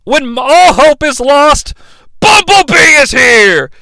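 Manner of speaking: slow, high, building to a shout; mock heroic announcement. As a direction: herald the hero with a drawn-out yell.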